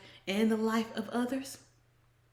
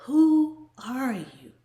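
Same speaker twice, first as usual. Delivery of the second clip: whisper; 5 semitones higher, 10 dB louder, much slower than usual. Pretend. Whispered line in sung chorus.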